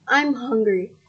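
groaning. Acted. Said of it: "the wife groaned".

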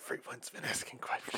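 secretive whisper. Acted. Conspiratorial hush continues.

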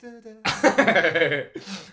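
very slow drawn-out spooky announcer; ominous title voice. Pretend. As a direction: stretch it out like a horror trailer. Dramatic show-title voice.

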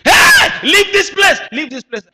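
preacher voices screaming demons. Retold or imitated. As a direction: shriek like a possessed person. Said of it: "began to scream".

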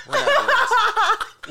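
slow, stretched, breaking into laughter; laughing. Real, not acted. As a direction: drag the words out while cracking up. Said drawn out while laughing.

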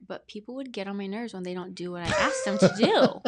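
loud, bursting into laughter mid-sentence; laughing through emotion. Real, not acted. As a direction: laugh it off as the feelings rise. Laughs while getting emotional.

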